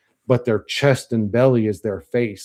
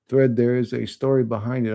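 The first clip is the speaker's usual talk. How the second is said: usual speaking voice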